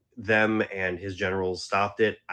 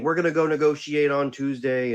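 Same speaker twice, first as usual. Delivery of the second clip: higher, louder, cocky official voice; mock official; 6 semitones higher, 4 dB louder than usual. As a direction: send up the boastful promise. Mocking US officials' promise.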